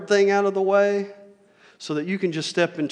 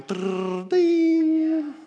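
slot machine; 9 semitones higher than usual. Retold or imitated. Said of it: "like a slot machine".

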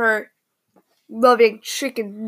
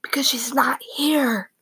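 whisper; 2 semitones higher than usual. Real, not acted. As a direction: whisper it though nobody's listening. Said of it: "But I can talk normally now".

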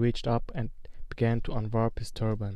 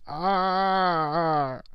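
high cry of pain; a sharp, high yelp of pain; 6 semitones higher than usual. Acted. Quirrell burned by Harry's touch, high pained cry.

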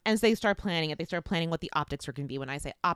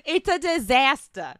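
loud, high, slow hammed-up lament; mock dismay; 6 semitones higher, 8 dB louder, slower than usual. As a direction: declare it like a melodramatic catastrophe. Mock dismay at cancelled visit.

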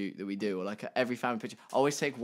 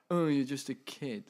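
mock talking-down tone; condescending; 3 semitones higher than usual. Older person talking down to the young, mildly condescending. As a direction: dismiss them as a mere youngster, lightly mocking. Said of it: "talk down to you because you're young".

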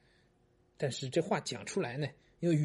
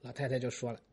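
ordinary talk

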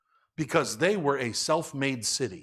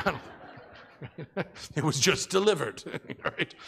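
breaking up mid-impression; laughing; 3 dB quieter, slower than usual. Real, not acted. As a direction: laugh through the words, losing the act. Cracks up during own impression.

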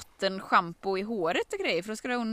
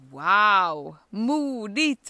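impressed; 8 dB louder, much slower than usual. Retold or imitated. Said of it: "Så jag bara så här".

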